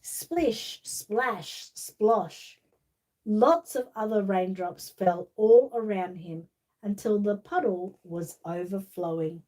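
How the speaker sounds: slow, lively storybook reading; reading to children. Acted. Reading The Little Raindrop to child viewers, storybook voice. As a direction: read it like bedtime story time. Reads picture book to children.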